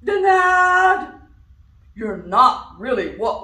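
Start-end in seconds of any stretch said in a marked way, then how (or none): 0.0-1.2 booming lion's loud verdict; loud denial. Acted. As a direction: bark it out as the lion. Lion rejects duck.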